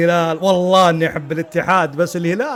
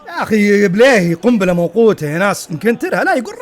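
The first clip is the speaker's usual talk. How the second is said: loud, raised-pitch complaint; exasperated scolding; 3 semitones higher, 6 dB louder than usual. Real, not acted. Fan scolding Blehi as a time bomb, loud exasperation. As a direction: burst out at the player. Scolds player over missed chance.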